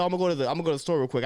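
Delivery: rattled off fast; rushed quote. Retold or imitated. Speaker retelling his own casual line, fast. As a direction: fire off the line quickly. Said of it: "I said".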